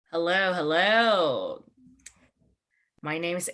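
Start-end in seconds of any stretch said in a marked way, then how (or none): none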